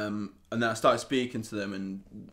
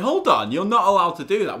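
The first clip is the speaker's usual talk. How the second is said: retold protest; 7 semitones higher, 6 dB louder than usual. Retold protest at bouncer grabbing phone.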